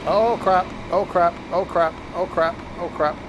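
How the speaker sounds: rising alarm as things go wrong; mild panic. Real, not acted. Train driver fearing a missed stop, mildly panicked. Train overshooting while braking.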